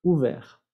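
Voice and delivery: Ouvert with R sounded, no T; silent T. Shown as a demonstration. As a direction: end Ouvert on the R. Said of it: "Masculino no se pronuncia la T".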